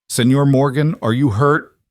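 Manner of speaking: high, loud concerned call. A narrator's reading. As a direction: call out from a distance. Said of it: "Miguel called out".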